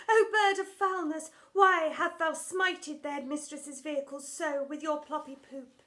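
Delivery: high, loud, overwrought stage voice; hammed-up old-English drama queen; theatrical Shakespearean lament. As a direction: wail it like a tragic heroine on stage. Drama teacher scolds a bird.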